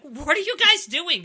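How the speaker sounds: high, loud disbelieving shout; incredulous yell. Retold or imitated. Shouting at the tough squad, loud and incredulous. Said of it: "I'm like".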